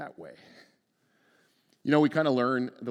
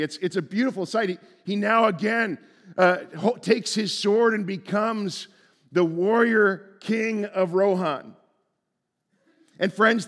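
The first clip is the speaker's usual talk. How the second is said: louder, higher preaching that builds; rising pulpit fervor; preaching at full force; 6 semitones higher, 6 dB louder than usual. Acted. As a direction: preach it out to the whole room.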